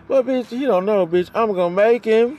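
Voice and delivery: high, loud defiant vow; defiant outburst. Real, not acted. Vowing to force her boyfriend's hand, loud and high. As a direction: shout it as a promise. Ranting about partner.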